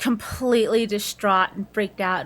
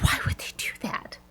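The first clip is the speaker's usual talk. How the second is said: upset whisper; 8 dB quieter than usual. Retold or imitated. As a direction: whisper it twice, bewildered. Peter upset, retold quote.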